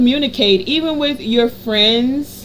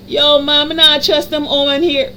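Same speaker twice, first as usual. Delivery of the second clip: put-on playful, slightly higher Jamaican accent; mock Jamaican accent; 4 semitones higher, 3 dB louder than usual. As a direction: toss it off in a joking Jamaican accent. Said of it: "I don't know where the Jamaican accent came from".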